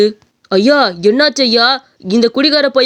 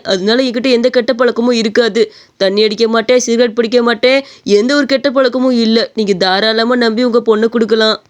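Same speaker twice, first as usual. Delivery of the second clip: ordinary delivery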